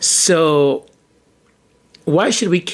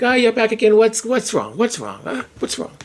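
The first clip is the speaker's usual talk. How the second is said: impatient doctor; 7 semitones higher than usual. Retold or imitated. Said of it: "his family doctor was like".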